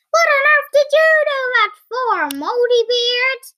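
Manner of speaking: high, loud, fast outrage; acted angry yell. Second giant punched by his brother, yelling angrily. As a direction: yell it indignantly at your brother.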